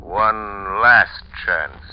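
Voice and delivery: very slow, loud, every word weighted; final warning. Acted. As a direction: lean hard on each word like a verdict.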